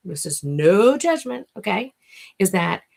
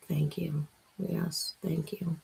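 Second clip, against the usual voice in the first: ordinary delivery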